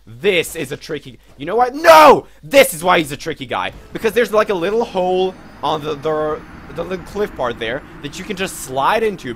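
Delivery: loud, high, worked-up ranting; excited rant. Real, not acted. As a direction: explode with frustrated excitement. Excited rant about tricky enemy.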